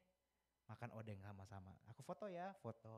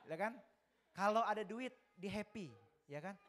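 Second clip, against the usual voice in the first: excited; 8 semitones higher, 13 dB louder than usual. Real, not acted. Amused punchline about the child.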